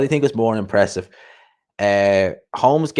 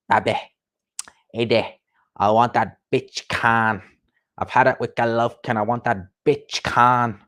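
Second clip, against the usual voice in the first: Kell Brook impression. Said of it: "Kell Brook impression is good".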